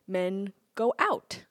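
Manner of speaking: ordinary talk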